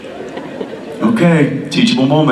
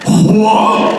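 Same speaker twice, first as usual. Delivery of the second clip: shocked whoa; 12 semitones higher than usual. Retold or imitated. Dad shocked at rude reply.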